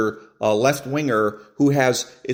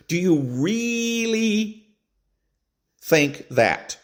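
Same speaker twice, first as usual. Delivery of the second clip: "really" stretched way up high and slow, incredulous; "Do you really" slow, with "really" pitched far up in mock doubt; mocking stressed really; 10 semitones higher, much slower than usual. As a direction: drag out "really" in a high, disbelieving sneer. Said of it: "That was the trigger word".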